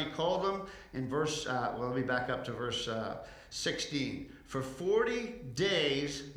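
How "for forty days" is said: forty drawn out slowly and stressed; slow right down and lean on forty; slow emphasis on forty. Shown as a demonstration. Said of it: "I want you to remember that one scripture".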